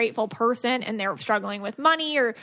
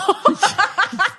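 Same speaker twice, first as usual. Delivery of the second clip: burst of laughter; 9 semitones higher, 5 dB louder than usual. Real, not acted. Laughs after quote.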